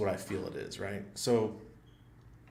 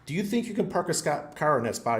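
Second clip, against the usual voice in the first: raised, loud mocking questions at the guard; smart-ass sarcasm; 7 semitones higher, 4 dB louder than usual. Sarcastic driver mocking the guard's math, loud. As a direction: needle the rent-a-cop about his math, loudly. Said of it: "me, I'm a smart ass".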